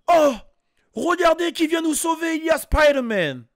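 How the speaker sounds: high, exclaiming Canadian French dub; Canadian French dub accent. Acted. Onlooker greets the hero in a Canadian French dub accent. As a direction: mimic a Canadian dub with English names. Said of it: "en mode canadien".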